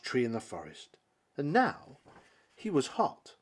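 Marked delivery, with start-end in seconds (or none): none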